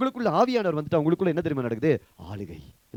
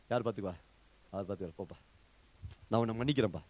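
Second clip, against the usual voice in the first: low, quiet imitation of a believer; acted dismissive forgiveness; 9 semitones lower, 7 dB quieter, much faster than usual. Believer tells someone he forgives him, acted low and quiet. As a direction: drop the voice well down, as if brushing someone off. Acts out congregation's grudging forgiveness.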